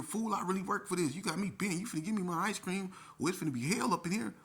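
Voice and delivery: high-pitched, loud demand; indignant outburst. Retold or imitated. Player demanding the ice cream he won, indignant.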